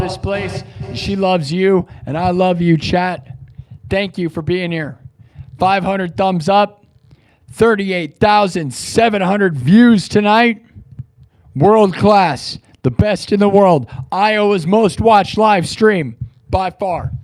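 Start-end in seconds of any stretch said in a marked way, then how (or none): 5.6-17.1 louder, slow hype-man boasting; excited hype. Real, not acted. Streamer boasting his numbers to chat, loud slow hype. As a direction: pump up the chat, loud and proud.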